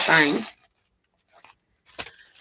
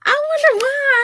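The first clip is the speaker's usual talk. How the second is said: laughing squeal; slower than usual. Real, not acted. Laughing at robot comments.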